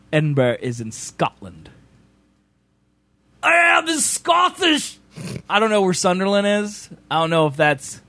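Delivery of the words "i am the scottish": shouted high and slow, a hammed-up Scottish boast; hammed-up declaration of being Scottish; mock Scottish proclamation. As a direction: bellow it like a proud clansman. Mock proclamation while viewing map.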